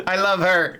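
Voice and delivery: squealing with laughter; laughing harder. Real, not acted. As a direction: gush it out through a laugh. Delighted by a character.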